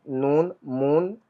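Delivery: नून, मून said slowly and softly; slow careful word demo. Shown as a demonstration. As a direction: draw out नून and मून for learners. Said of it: "एन का उच्चारण न ही रहता है".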